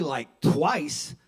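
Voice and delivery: ordinary delivery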